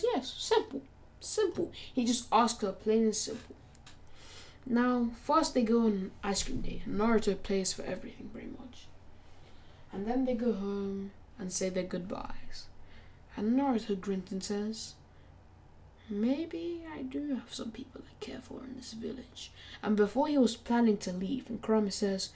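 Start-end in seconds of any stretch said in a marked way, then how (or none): 0.0-1.7 much higher put-on girl voice; girl's voice. Ino eagerly answering Naruto, in a much higher girlish voice. Girl accepts being his girlfriend. 16.1-19.5 soft, higher whisper; inner thought. Acted. Naruto musing to himself after the date, whispered.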